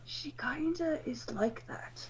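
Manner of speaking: slow, hushed aside; whisper. Acted. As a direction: whisper it confidentially over your shoulder.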